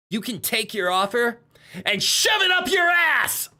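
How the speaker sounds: high, loud defiant hero shout. Acted. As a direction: yell it at the villain. Angry hero tells villain off.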